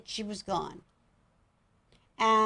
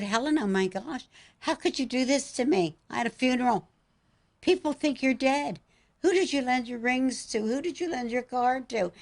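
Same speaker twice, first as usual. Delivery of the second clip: high, pleading disbelief; higher, pressing questions in disbelief; astonished; 5 semitones higher than usual. Retold or imitated. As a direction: cry out in shocked wonder at her. Mother astonished at dead daughter.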